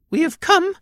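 very high, loud squeaky mouse; high-pitched cartoon mouse voice; tiny alien mouse. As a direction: squeak it out like a proud little mouse. Tiny alien mouse speaking in story.

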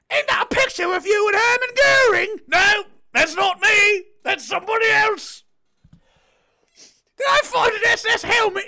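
high, shouted hammed-up child and guilty father; mock family interrogation. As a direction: shriek the questions, squeal the denials. Acted child-father war dialogue.

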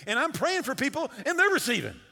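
loud, high acted protest; exasperated retold outburst. Preacher acting a crying elder's protest to him, exasperated outburst.

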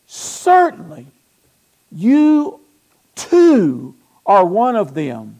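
loud, high, slow accusation; single him out with long pauses between words; drawn-out, pointed charge from a bystander; acted accusation. Acts bystanders accusing Peter.

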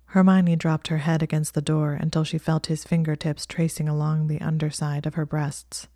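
usual speaking voice

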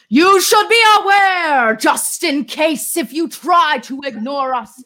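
high, loud, slow threatening warning; hammed-up villain bellowing a warning; menacing shout. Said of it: "in the style of the Ziggy ransomware".

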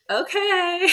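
high, puzzled mom voice, lightly imitated; a quick high-pitched mock of her mother's doubtful okay; imitating mom. Mom on FaceTime answering her laughing, crying daughter, high and puzzled.

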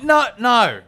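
loud, high-pitched acted protest; exasperated dad putting his foot down; exasperated refusal. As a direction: shut the request down with a loud, high no.

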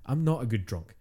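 ordinary delivery

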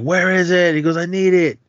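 high, insistent demand; lightly voiced thug pressing; mock yelling demand. Thug pressing the manager for the safe, mock yell.

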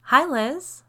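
high, loud, breezy hello; casual greeting. A narrator's reading. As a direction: greet like a chance meeting in the grocery store. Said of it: "as though we'd just run into each other in the grocery store".

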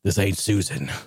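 low, gruff hero voice; a hammed-up low action-hero voice; tough-guy FZ1. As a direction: drop low and play it cool. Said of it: "F Z one is like".

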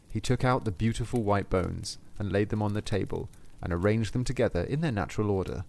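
plain reading voice

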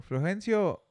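name called out high; mock roll call. Storyteller calling a made-up name, mock roll call.

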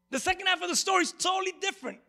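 normal talking voice

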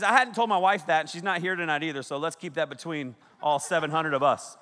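ordinary talk

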